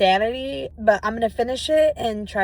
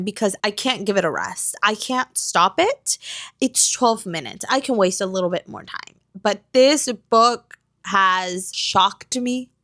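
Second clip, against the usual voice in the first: normal talking voice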